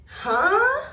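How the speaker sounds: a high, loud, disbelieving huh; stunned huh. Real, not acted. Told she'd make a good stepmother, a high shocked huh. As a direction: react as if you misheard.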